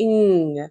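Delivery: "ఇంగ్" with a long humming end; drawn-out ng. Shown as a demonstration. Teacher repeating the ing sound, ending held long. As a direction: hold the ng of "ఇంగ్". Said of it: "ఇంగ్ symbol చూడండి".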